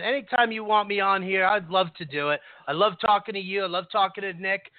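ordinary talk, nothing marked

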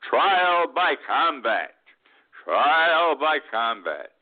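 slow, drawn-out mock war cry; mock Giuliani rally cry. Retiree mimicking Giuliani to the crowd, slow mock battle cry. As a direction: stretch it out like a crazed rallying call. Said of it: "he said to this group of thousands".

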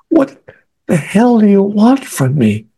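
high, drawn-out exasperated demand; retold inward scream. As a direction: demand it at a high pitch, acting out anguish. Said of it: "I'm screaming inside my head".

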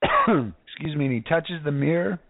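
normal talking voice

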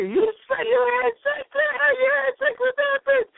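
high, shrieking accusation; hammed-up furious girlfriend; imitating an enraged girlfriend; mock furious. Girlfriend attacking him, retold.

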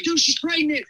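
high-pitched mock panic, volume held in; retold flipping out. Repeating the pregnancy news to best friend, freaking out. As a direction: re-enact urgent news to a buddy. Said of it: "I'm, like, flipping out".

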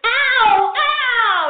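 high, loud little ouch; child yelping. Retold or imitated. Little girl yelping while mother brushes her hair. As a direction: yelp like a kid in pain.

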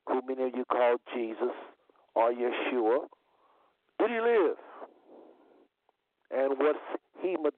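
usual speaking voice

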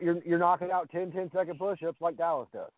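usual speaking voice

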